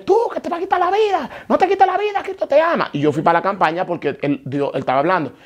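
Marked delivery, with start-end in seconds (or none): 0.0-2.9 much higher imitated preacher voice, pleading; pleading church brother. Church brother begging Nicole not to take his life, high pleading voice. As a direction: mimic the brother begging him not to die, voice pitched far up. Brother quoting to suicidal man.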